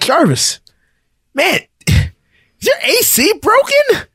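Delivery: high-pitched mock complaint, climbing to the end; exasperated retold question. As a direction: act out an incredulous, rising question. Retold confrontation over broken car AC.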